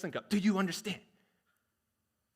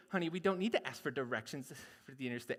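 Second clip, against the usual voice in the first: lower, softer, fast grumbling reply; stubborn husband; 5 semitones lower, 7 dB quieter, faster than usual. Acted. Husband refusing to ask directions, low grumble. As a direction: brush her off, low and quick. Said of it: "do all the dialogue".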